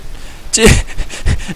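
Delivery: breathy delighted laugh; excited laugh. Real, not acted. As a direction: laugh in disbelief. Laugh after the game ends.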